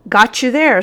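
gotcha, gloating at the captain; triumphant. A narrator's reading. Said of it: "said the sergeant".

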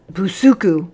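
sergeant's sharp exclamation; exclaimed. A narrator's reading. Sergeant calls out the culprit's name, exclaimed. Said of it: "exclaimed the sergeant".

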